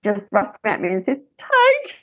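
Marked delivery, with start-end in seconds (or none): 1.4-1.9 high, strained, close to crying; tearful plea. Retold or imitated. Daughter handing the baby to her mother, a high tearful plea. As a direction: voice the engorged daughter begging through tears. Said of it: "tears were rolling down her face".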